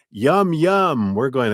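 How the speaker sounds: high, loud gleeful yum; mock delight. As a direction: savour it like a treat. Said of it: "it starts to go".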